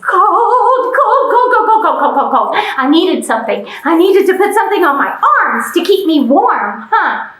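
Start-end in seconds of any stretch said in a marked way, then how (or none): 0.0-2.5 shivering cold. Pretend.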